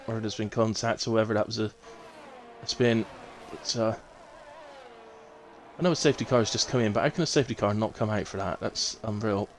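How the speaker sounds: ordinary talk, nothing marked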